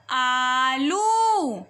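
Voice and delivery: आलू with the ऊ drawn out slowly; teacher drawing out आलू; stretched vowel. Shown as a demonstration. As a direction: pull the ऊ in आलू long. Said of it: "ल को बड़े ऊ की मात्रा बन गया लू".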